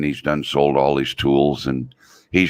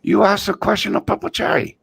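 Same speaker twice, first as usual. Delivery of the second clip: put-on much higher broken-English old man voice; old Chinese man imitation. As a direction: squeak out the old Chinese guy's line. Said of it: "the little old Chinese guy says".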